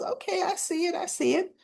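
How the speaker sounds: lightly mock knowing tease; teasing I see it. Colleague teasing her about looking the part, knowing tease.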